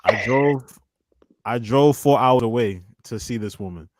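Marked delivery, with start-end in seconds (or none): none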